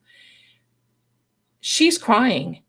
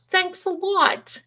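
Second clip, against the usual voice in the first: sarcastic thanks; 10 semitones higher, slower than usual. Real, not acted. Sarcastic aside after being left last.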